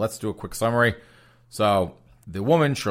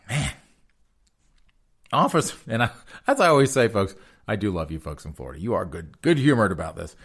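usual speaking voice

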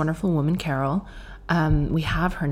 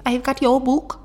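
put-on Newcastle accent; 8 semitones higher than usual. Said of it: "I thought she had said boob".

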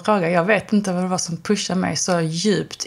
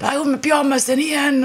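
mock excited shout; 8 semitones higher than usual. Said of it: "att folk bara".

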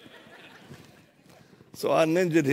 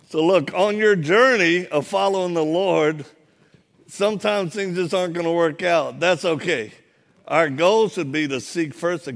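raised, louder sermon voice; preaching; 4 semitones higher, 5 dB louder than usual. Acted. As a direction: drive the point home to the congregation. Preaching climax.

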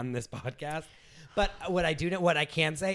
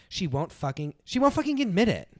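exasperated outburst; 6 semitones higher than usual. Acted. Lauren, fed up after reading the letter, bursting out. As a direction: blow up at the end.

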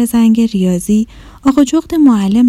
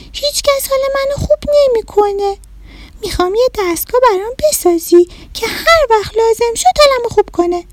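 little rabbit; 11 semitones higher, faster than usual. Acted. Little rabbit telling the mouse, high sad child voice. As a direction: play a small sulky bunny, pitched way up.

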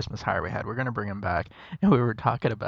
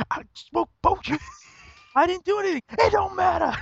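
mock voice. Mock voice while laughing.

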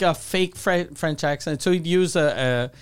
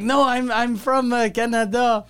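higher-pitched mock French accent; fake French accent; 5 semitones higher than usual. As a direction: imitate a friend's hammy French-accented English. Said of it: "in his fucking fake French accent".

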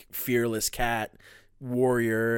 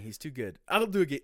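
ordinary talk

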